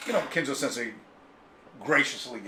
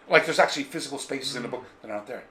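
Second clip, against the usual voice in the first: ordinary talk, nothing marked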